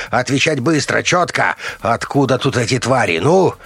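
high-pitched, insistent demands; shouted interrogation. A narrator's reading. Colonel demanding answers after a slap, yelling. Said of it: "громко заорал Решетников".

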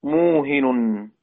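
very slow "موهن", muhin, drawn out; teacher lingering over "موهن" for the class; slow demo. Shown as a demonstration. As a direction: stretch "موهن" out, mu-hin, each sound exact. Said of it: "الكلمة الثانية".

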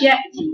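"يأتي" with a slack hamza, as a mistake; don't close off the hamza in "يأتي"; wrong model of loose "يأتي"; unclosed hamza. Shown as a demonstration. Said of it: "أنت ما قفلتش المخرج".

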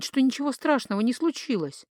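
usual speaking voice

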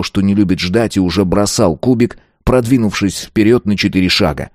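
ordinary delivery, nothing marked

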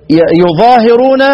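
يظاهرون said loudly with a rising tune; emphatic reading. Shown as a demonstration. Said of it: "لا، هناك يقرأ إيه؟". (يظاهرون بصوت عال وتأكيد)

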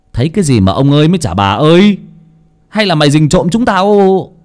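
loud, sharp scolding mistress; snapping. Acted. Mistress scolds the maid with a sharp loud snap. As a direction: snap at the servant impatiently. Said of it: "Thị Liễu cũng gắt lên".

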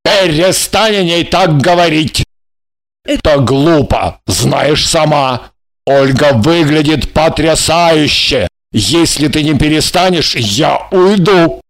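slow, firm man's voice; measured male reproof ending in a threat; threatening. A narrator's reading. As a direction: warn them slowly and deliberately. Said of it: "пригрозил Жарон".

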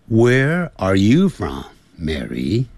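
"you" slow, lifted and leaned on; slow drill stressing "you". Shown as a demonstration. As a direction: hit "you" and drag it out. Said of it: "这个强调的是you".

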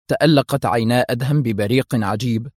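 ordinary delivery

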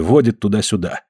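ordinary delivery, nothing marked

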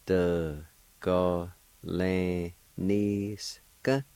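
"the, go, lan, ni, ska" evenly separated, rising on "ska"; syllable-by-syllable pronunciation. Shown as a demonstration. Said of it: "Second part".

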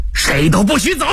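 high, forceful shouted order; sudden roar. A narrator's reading. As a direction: roar to stop everyone leaving. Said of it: "峰哥猛地吼道". (猛地高声怒吼)

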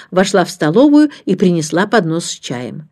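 ordinary delivery, nothing marked

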